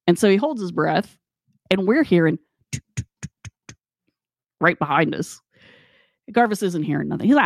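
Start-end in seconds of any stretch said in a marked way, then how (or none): 3.0-3.8 quiet clicked steps; imitated footsteps. Imitating footsteps right behind the group, soft clicks. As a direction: make soft, steady footstep ticks.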